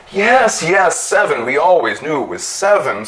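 eager, high agreeing; agreeably. A narrator's reading. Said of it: "said Clopacius agreeably".

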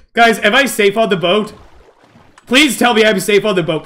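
ordinary delivery

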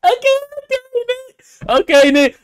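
high, excited cheer; thrilled, squealed approval; hyped approval. Real, not acted. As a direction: hype up a friend in a high voice. Hyped reaction to friend's line.

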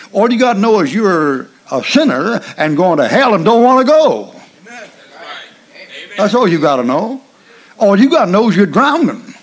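loud, rising preacher's cry; preaching at full force. Acted. Preacher to congregation, driving the point in a rising shout. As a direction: build the call until it rings.

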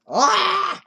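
high, loud held scream; frustrated scream. Real, not acted. Failing the game.

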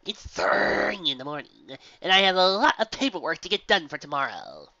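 a tired but showy gym leader. Acted.

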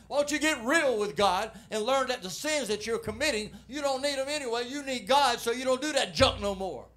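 loud, high preaching voice; fiery preaching. Acted. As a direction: preach it out to the church with fire. Preaching at full force.

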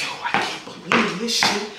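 soft, thrilled; quiet excitement. Retold or imitated. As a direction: breathe it excitedly. Excited in Uber.

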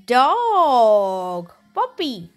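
ordinary talk, nothing marked

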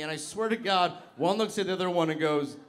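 normal talking voice

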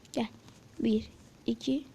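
usual speaking voice

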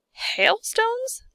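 high, rising disbelief; incredulous question. A narrator's reading. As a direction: question it as if absurd. Centipede scoffs at the news.

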